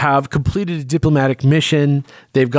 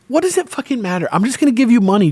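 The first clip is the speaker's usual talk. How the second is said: higher-pitched exasperated queen; mock exasperation; 8 semitones higher, faster than usual. Queen to the man objecting to the job, exasperated. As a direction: act out brushing off an objection.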